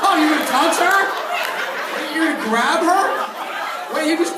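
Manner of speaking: high, loud, laughing disbelief; laughing shout. Real, not acted. Host shouting at guest's move.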